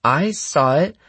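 high voice with a note of doubt. A narrator's reading. Brunette girl backs up her friend's claim, doubtful. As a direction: claim it loudly but not quite surely. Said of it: "a note of doubt in her voice".

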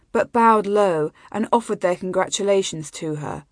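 plain reading voice

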